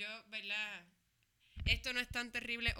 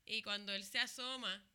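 ordinary talk

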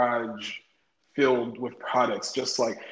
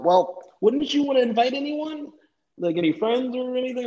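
much higher, coaxing recruiter voice; retold recruiter; 10 semitones higher than usual. Recruiter nudging him to invite people, retold high. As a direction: voice an eager recruiter, pitched high.

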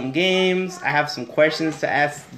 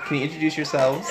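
ordinary talk

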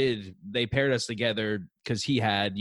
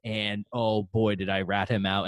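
ordinary talk

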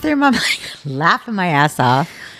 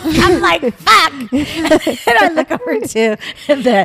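laughing shout; 9 semitones higher, slower than usual. Real, not acted. Woman wetting herself at dinner, laughing shout. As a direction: blurt it out mid-laugh.

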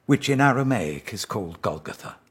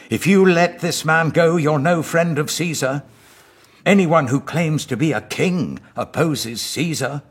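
higher, louder, urgent shouting; leaders shouting; 8 semitones higher, 8 dB louder than usual. Acted. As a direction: shout the threat at him, pressing hard. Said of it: "kept shouting".

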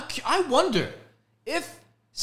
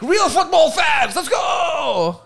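loud, higher hammed-up fan yell; mock football fan cheer; 9 semitones higher, 7 dB louder, much slower than usual. Podcast host mimicking rowdy football fans, mock cheer. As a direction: whip up the crowd like a diehard fan.

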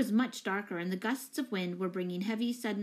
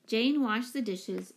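plain reading voice

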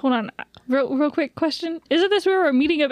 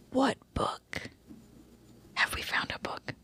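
whispering; 11 dB quieter than usual. Real, not acted. Whispered aside to party.

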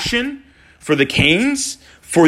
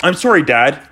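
ordinary talk

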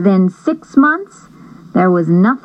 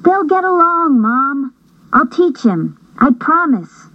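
put-on high earnest girl voice; promising girl; 7 semitones higher than usual. Julie promising her mom about the dog, high and earnest.

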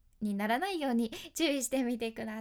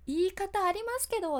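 mock indignant retort; 7 semitones higher, 5 dB louder than usual. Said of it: "って思わん".

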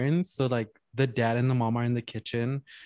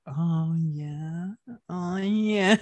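mock moaning; 5 semitones higher, much slower than usual. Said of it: "and they're like".

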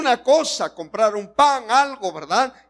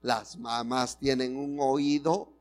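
ordinary delivery

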